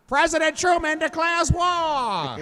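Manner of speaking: high, slow, drawn-out proclamation; mock news announcement. Co-host jokes after "in other news", mock news announcement. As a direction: stretch it out like a big wartime headline.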